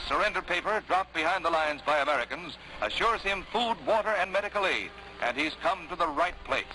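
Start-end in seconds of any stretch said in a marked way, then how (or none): none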